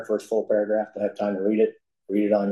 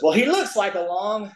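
a lightly voiced country aunt, much higher; delighted aunt at the front porch, lightly voiced and higher; aunt's greeting; 10 semitones higher than usual. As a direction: chirp it up high like a beaming little aunt.

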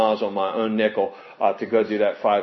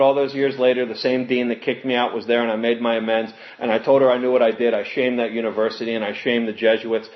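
ordinary talk

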